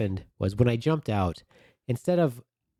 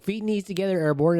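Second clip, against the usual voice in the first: mock sergeant yell; 11 semitones higher, 3 dB louder than usual. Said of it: "they're yelling at me the whole time".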